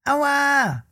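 very high, squeaky worm yelping in protest; a tiny creature crying out, offended; indignant worm. Acted. Worm inside the apple gets bitten, very high squeaky yelp. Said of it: "schimpfte es da auf einmal".

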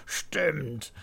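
amused grandpa owning up; chuckling old man's agreement; laughing admission. A narrator's reading. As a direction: say it on the tail of a laugh. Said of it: "lacht Opa".

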